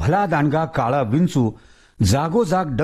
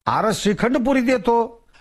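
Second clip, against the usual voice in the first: higher, coaxing village elder; coaxing Tatya; 8 semitones higher than usual. Acted. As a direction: sweet-talk the stubborn ghost. Tatya offering food.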